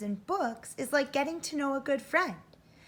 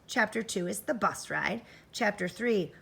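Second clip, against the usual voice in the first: ordinary talk, nothing marked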